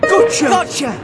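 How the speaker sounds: high loud relieved shout; triumphant cry. Acted. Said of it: "cried Horace and Fergus together".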